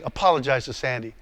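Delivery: low, unmoved command; acted voice of God, firm and low; God's voice. God's unmoved reply in acted argument.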